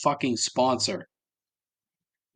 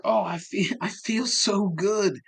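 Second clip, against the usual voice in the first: mock relieved sigh; 9 semitones higher, slower than usual. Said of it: "the woman's like".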